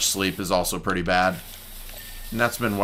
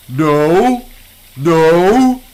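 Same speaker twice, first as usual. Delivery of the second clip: loud no; 6 semitones higher, 6 dB louder than usual. Acted. Reacting to the game.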